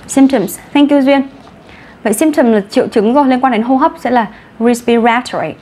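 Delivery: ordinary delivery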